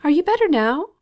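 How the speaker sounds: high, loud, trembling question; quavering voice. A narrator's reading. Fanny anxiously asking the fainted Margaret, quavering. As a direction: ask it with a nervous quaver. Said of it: "in a quavering voice".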